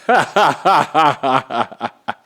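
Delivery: long rapid belly laugh; real laugh. Real, not acted. Cracking up at his own story, real hearty laugh. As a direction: burst out laughing in a rattling run.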